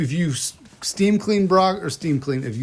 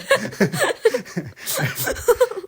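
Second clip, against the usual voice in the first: high laugh. Real, not acted. Laughing at own punchline.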